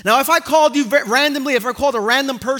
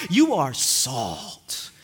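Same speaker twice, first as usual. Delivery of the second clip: voicing Jesus; 11 semitones lower, 6 dB quieter, much slower than usual. Preacher voicing Jesus's blessing.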